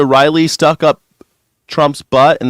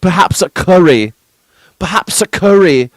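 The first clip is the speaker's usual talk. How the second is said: mock British accent; Steve Hilton; 3 semitones higher than usual. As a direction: answer like a prim, hammed-up Englishman. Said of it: "Steve Hilton".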